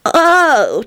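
put-on high, puzzled child's oh; high-pitched puzzlement. A narrator's reading. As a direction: sound mildly confused, like a little kid. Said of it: "responded in a puzzled voice".

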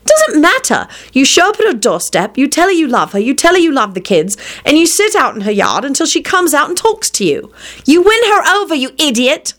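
high, insistent bossing; exasperated urging. Acted. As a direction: lay it out for an idiot brother. Exasperated sister orders brother about.

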